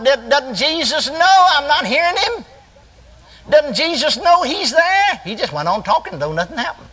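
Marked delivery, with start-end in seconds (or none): 0.0-5.2 high, drawn-out pleading question; acted distressed thought. Preacher acting out not hearing Jesus, high distressed pleading. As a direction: protest slowly in a raised, bewildered voice. Retold anxious thoughts in vision.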